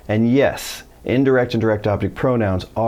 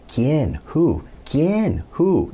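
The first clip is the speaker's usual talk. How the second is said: high-pitched baffled questioning; mock bewildered questions, pitched up; confused suspect; 7 semitones higher, much slower than usual. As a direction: imitate a suspect repeatedly asking, each one higher. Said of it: "the suspect the whole time constantly is like".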